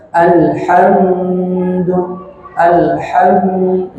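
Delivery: Alhamdu stretched very slowly; over-lengthened. Shown as a demonstration. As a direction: drag out Alhamdu too long. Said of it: "Atau digunakan panjang". (Alhamdu dibaca terlalu panjang)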